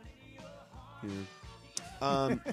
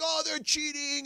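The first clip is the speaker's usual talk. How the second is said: mocking critics; 10 semitones higher, slower than usual. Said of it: "people ripping the Yankees".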